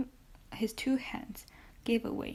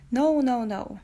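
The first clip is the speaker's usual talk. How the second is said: sharp loud protest, fading on the last no; cried out; 8 dB louder than usual. A narrator's reading. Dante to the unseen prisoner, a loud protesting cry. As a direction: cry out the denial, then let it drop. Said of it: "cried Dante".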